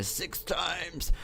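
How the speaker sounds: very high, strained exclamation; mock outcry. Exclaiming over how many times he shot, high mock outcry. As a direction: squeal it as a hammed-up outcry. Mimics Loomis's outcry about the shots.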